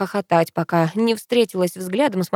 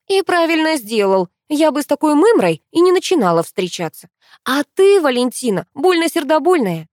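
louder, higher, scornful jab; 7 semitones higher, 5 dB louder than usual. Acted. Girl scoffing at her friend on the phone, louder and scornful. Heroine mocks rival to friend.

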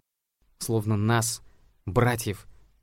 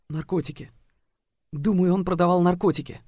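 put-on frightened girl; 9 semitones higher, 4 dB louder than usual. A narrator's reading.

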